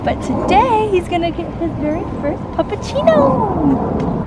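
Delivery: excited. Real, not acted. Excited announcement about the dog.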